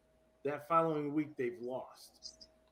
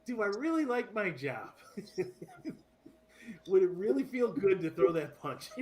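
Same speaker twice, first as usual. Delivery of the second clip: laughing; 6 semitones higher, 4 dB louder than usual. Real, not acted. Joking about punching coworker.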